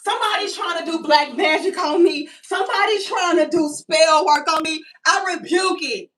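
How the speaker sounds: loud, lightly hammed protest; mock rebuke. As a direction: cast it off like a preacher. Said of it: "I said".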